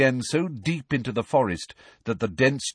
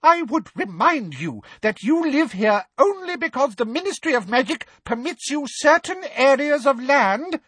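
very high, haughty reminder; indignant; 4 dB louder than usual. Acted. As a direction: lecture them shrilly. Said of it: "with indignation".

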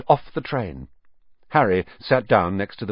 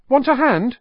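friendly twin voice; 14 semitones higher, 5 dB louder than usual. Acted. Twin offers help.

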